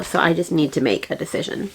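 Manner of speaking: ordinary delivery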